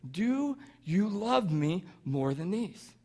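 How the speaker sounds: slow, soft, drawn-out asking; tender question at a very slow pace; searching question. Retold or imitated. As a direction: ask it softly, stretching each word. Jesus asking Peter a third time.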